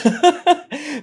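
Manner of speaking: high burst of laughter; a high, delighted laugh; bright laugh. Real, not acted. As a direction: laugh out loud. Host laughs at guest joke.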